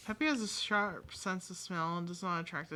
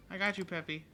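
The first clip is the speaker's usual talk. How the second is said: usual speaking voice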